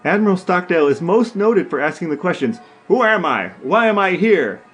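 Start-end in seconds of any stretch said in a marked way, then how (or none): none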